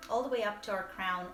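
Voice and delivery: ordinary delivery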